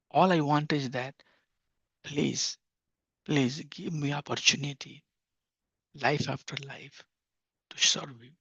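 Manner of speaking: soft, humble plea; devotional prayer. Acted. Devotee begs the Lord for service, softly. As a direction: pray softly, asking to serve.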